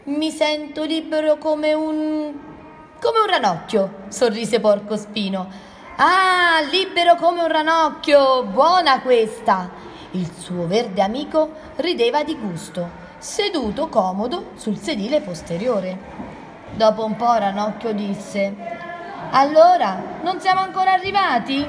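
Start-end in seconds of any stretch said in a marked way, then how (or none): 0.1-4.1 higher, searching for the comparison; raised pitch, pausing before the comparison; mild elation. Acted. Ranocchio enjoys the ride, mildly elated. Frog exclaims joy of travelling. 6.0-9.7 high, loud delighted appreciation; amused exclamation. Acted. Porcospino enjoys Ranocchio's joke, amused. 19.3-21.7 high, quick, impatient asking; impatient question. Acted. Ranocchio asks his friend after a while, impatient.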